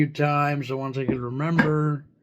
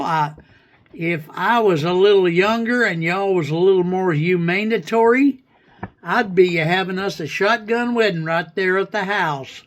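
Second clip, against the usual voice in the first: pretend hillbilly. Flirting with the AI in a light put-on hillbilly accent. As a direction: play a Kentucky hillbilly, lightly. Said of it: "can I talk in a hillbilly slang".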